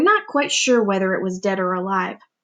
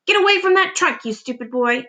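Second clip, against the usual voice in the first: put-on high, sharp scolding yell at the boy; Aunt Spiker yelling at James; angry yell; 5 semitones higher than usual. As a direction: yell him away from the trunk. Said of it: "Aunt Spiker yelled".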